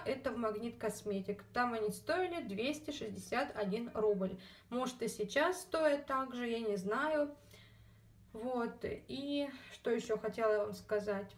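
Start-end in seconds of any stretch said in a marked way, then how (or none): none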